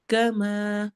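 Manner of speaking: ordinary talk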